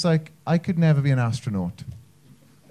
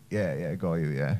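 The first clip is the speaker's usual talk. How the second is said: low, muted brush-off; offhand agreement; 12 semitones lower, 10 dB quieter than usual. Acted. Wales pretends to follow the flag brief, offhand. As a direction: agree under your breath, low.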